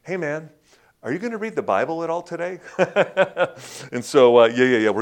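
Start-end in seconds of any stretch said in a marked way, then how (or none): 0.0-2.6 higher, fast mock heckle; impatient listener. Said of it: "you're like". 2.6-3.9 short amused laugh; real chuckle. Real, not acted. Laughs at own joke.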